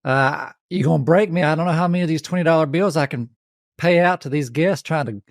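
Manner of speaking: turned-up Southern country twang. Pretend. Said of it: "Let me turn the twang up".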